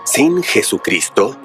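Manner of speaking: usual speaking voice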